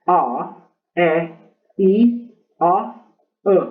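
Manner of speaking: A, E, I, A, E said as short English vowel sounds. Shown as a demonstration. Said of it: "Los sonidos cortos de las vocales".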